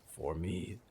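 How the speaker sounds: put-on soft hushed boy's words; whispered. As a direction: say it under your breath. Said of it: "Nolan whispered".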